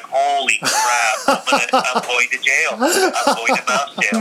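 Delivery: hammed-up dread of mouse jail; mock panic.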